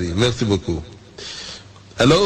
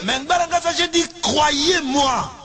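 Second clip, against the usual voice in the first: begging the interpreter to get it right; exasperated correction. Acted. Preacher despairs at interpreter. (correction exaspérée et aiguë)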